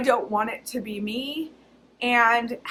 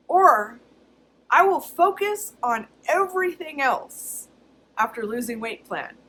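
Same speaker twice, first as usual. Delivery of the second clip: normal talking voice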